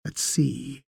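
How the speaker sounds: ordinary delivery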